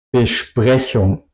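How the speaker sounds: "Besprechung" slowly, as be-shprechung; SP as SHP. Shown as a demonstration. Teacher applying the syllable rule, sh in "Besprechung". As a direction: say "Besprechung" with sh before the P. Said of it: "por eso se pronuncia".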